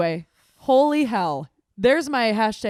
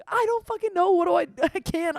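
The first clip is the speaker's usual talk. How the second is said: much higher panicked wail; retold freakout; 8 semitones higher than usual. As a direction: plead helplessly to her sister. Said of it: "I'm like".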